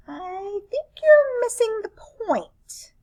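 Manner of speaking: high, slow whisper; whispered correction. Acted. Alien corrects the host who hates math, in a high whisper.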